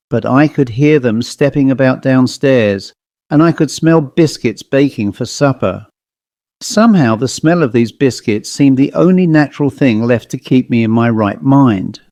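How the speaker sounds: usual speaking voice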